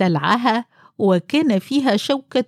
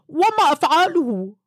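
helpless husband voice; 9 semitones higher than usual. Acted. Husband asking what to do.

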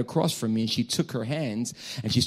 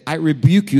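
loud, higher re-enacted rebuke; forceful rebuke; 4 semitones higher, 8 dB louder than usual. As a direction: belt it out like casting away evil. Preacher re-enacts rebuking the girl.

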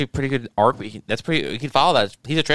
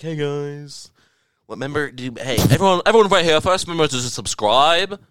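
parodied channel host plugging subscriptions; mock YouTuber. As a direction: put on a loser YouTuber's pitch to viewers. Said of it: "who's just saying, like".

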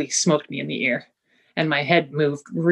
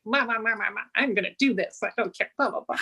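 higher, sing-song mock defiance; mocking stubborn grumble; 6 semitones higher, 5 dB quieter than usual. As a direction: brush everyone off, childishly defiant. Mocking her own stubborn refusal.

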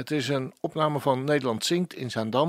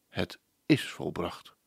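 hushed solemn; 7 semitones lower, 7 dB quieter than usual. Acted. Closing quoted words of Jesus.

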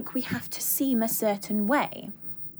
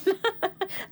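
real laugh; 9 semitones higher than usual. Real, not acted. Laughs at own habit.

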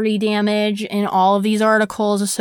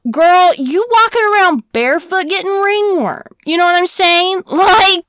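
higher and louder sassy clapback; mocking the town's hypocrisy, high and loud with sass; sassy callout; 7 semitones higher, 4 dB louder than usual. Real, not acted. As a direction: call her out, all attitude. Sassy rant at the townspeople.

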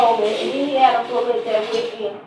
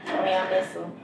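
normal talking voice

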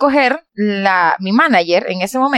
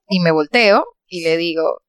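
usual speaking voice

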